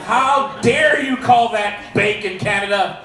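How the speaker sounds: a hammed-up shout of offence; mock indignant shout. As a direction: shout it like the bacon is a personal insult. Mock outrage at Canadian bacon.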